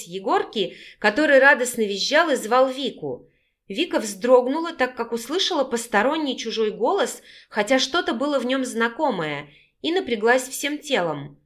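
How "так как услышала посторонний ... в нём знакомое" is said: plain reading voice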